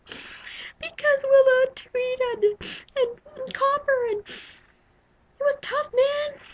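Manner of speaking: high, slow hammed-up sobbing; mock crying. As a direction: wail it out like a bawling baby. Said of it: "I bawled like a baby".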